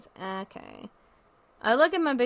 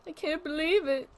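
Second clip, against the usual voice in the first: mock tearful parent; 7 semitones higher, 5 dB louder than usual. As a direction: gush like a weepy proud mom. Mock proud parent tearing up.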